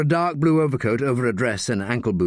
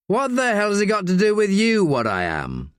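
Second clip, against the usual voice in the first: angry; 9 semitones higher than usual. Acted. Daniel rounding on Green's sectarian question, angry. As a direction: snap back at the bigot. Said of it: "angry and thoroughly depressed".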